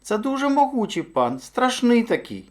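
high-pitched timid hare; high little hare voice; hare warning. A narrator's reading. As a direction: warn the lord in a small high voice. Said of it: "мовив заєць".

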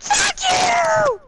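high screaming fuck you; furious shout. Real, not acted. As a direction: scream it at your friend. Denied the shotgun, explodes.

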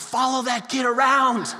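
mock playground rallying cry; imitating excited kids. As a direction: call out like a small child spotting treasure. Small kids calling out at egg hunt.

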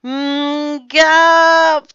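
high, loud gagging splutter; disgusted gagging. A narrator's reading. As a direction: choke and spit out a bad sip. Customer spits out salty lemonade.

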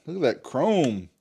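ordinary talk, nothing marked

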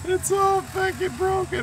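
high, drawn-out exasperated wail; squeaky exasperation. Real, not acted. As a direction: despair at the wrecked car. Car wrecked, exasperated.

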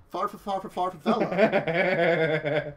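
far stretched slowly into Vela; drawn-out pun. Acted. Game master milking the favela pun, slow. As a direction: draw out each far, landing the pun.

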